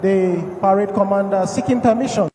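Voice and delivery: loud formal MC address; ceremony announcer. Acted. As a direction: announce it to the whole square.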